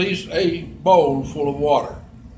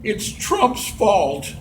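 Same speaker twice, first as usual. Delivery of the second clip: slow, drawn-out mock whine; hammed-up sobbing blame; mock crying; 3 semitones higher, much slower than usual. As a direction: imitate a tearful complainer. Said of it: "she's crying and she's saying".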